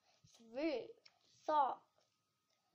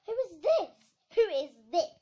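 loud, imperious snap; snapped demand; 5 semitones higher, 14 dB louder than usual. A narrator's reading. Queen of Hearts confronting a stranger, snapping. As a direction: demand an answer, very loud and sharp. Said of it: "snapped the Queen".

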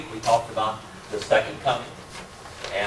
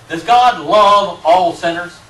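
loud challenge; 7 semitones higher, 13 dB louder, slower than usual. Real, not acted. Challenging question to class.

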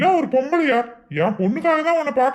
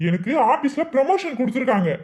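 normal talking voice

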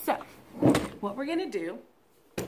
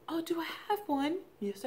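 usual speaking voice

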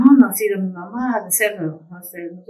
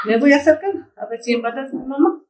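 ordinary talk

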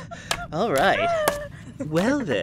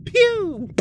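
whispered gunshot noise. Retold or imitated. Mimicking gunshot after suicide note.

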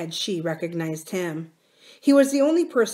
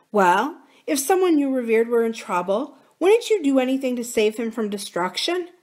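higher, earnest appeal; lightly voiced pleading wife; 5 semitones higher, 4 dB louder than usual. Wife persuading her husband, lightly pleading. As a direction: lead him along, pitched higher.

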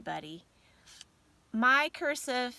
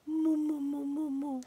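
cooing the name; 3 semitones higher than usual. Real, not acted. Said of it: "I have a habit of doing that".

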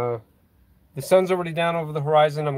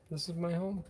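softly repeated; 4 semitones higher, 14 dB quieter than usual. Real, not acted. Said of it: "repeated softer".

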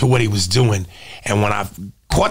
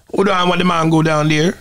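put-on Jamaican patois; amateur Jamaican accent; 2 semitones higher than usual. Shown as a demonstration. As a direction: try a Jamaican accent for show. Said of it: "Like, true Jamaican accent".

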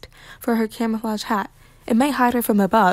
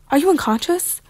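high, anxious question; alarmed, raised-pitch rising question; panicked; 6 semitones higher than usual. A narrator's reading. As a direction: ask in mild panic. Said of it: "said Sky in a panic".

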